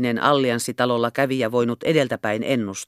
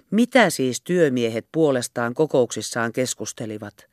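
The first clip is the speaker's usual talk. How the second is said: plain reading voice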